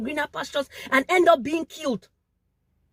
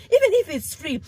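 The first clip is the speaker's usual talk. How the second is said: louder and higher, pressing the point; emphatic outburst; 7 semitones higher, 4 dB louder than usual. Real, not acted. Pleading with fellow Africans, emphatic. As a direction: hit "even if" hard in protest. Impassioned plea about xenophobia.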